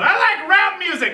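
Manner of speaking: high, sudden blurt; yelled blurt. Retold or imitated. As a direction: repeat the nervous yell. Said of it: "she just yells out".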